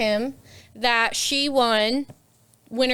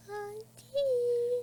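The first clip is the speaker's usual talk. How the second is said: high soft auntie; 12 semitones higher, 11 dB quieter than usual. Retold or imitated. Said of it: "I was like".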